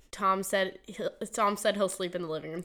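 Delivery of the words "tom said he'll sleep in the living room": ordinary talk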